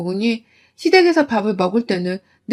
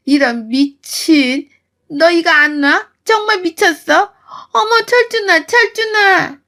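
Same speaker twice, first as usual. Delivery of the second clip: 아주 높게 울먹이며 아들 부르기; 다급하게 울부짖듯; 6 semitones higher, slower than usual. Retold or imitated. 머리채를 잡혀서는. (머리채 잡힌 시어머니가 아들을 부름)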